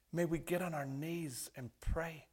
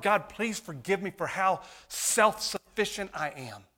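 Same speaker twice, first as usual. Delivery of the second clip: loud pleading prayer; 5 semitones higher, 7 dB louder than usual. Acted.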